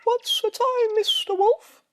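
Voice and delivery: very high, loud chirpy bird voice; tweeting blackbirds. A narrator's reading. Blackbirds chirping at the sleepy wolf, very high and bright. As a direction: squeak it out like little birds. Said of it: "they tweet".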